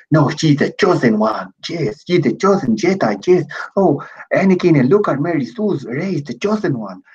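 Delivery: mocking shill voice. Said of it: "This is my Latin shill voice".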